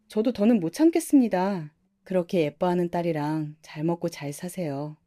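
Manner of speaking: ordinary delivery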